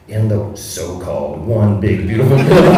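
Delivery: grudgingly forced to say the name; a mild mock sneer; mock grudging opponent. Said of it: "have to say".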